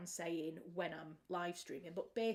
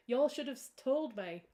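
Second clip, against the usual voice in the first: American accent; 5 semitones higher, 8 dB louder than usual. Shown as a demonstration. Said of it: "y'all should have" all as one word is apparently very American".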